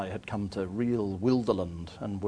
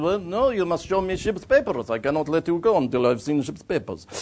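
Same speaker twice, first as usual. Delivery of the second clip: lightly voiced stubborn official, pitched up; Bulgarian harbour master; 6 semitones higher, 4 dB louder than usual. Border official refusing to let traveller go, stubborn. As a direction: refuse firmly as a foreign official. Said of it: "He said".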